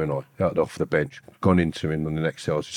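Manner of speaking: usual speaking voice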